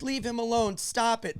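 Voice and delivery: high voice, protesting without shouting. Retold or imitated. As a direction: imitate a distressed friend stepping in.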